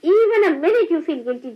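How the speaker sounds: ordinary talk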